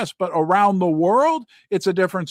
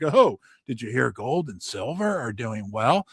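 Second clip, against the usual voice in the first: mock suspicious; 6 semitones lower, 3 dB quieter than usual. Mocking suspicious media voice.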